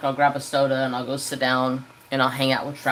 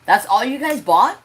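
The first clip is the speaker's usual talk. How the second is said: high, loud disbelief; asking in shocked disbelief; stunned at the tiny grocery haul; incredulous question; 7 semitones higher, 4 dB louder than usual. Retold or imitated. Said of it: "And I was like".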